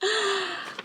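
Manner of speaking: soft, high gasp; sharp intake of delighted surprise; astonished gasp. Real, not acted. Sees the wrapped book.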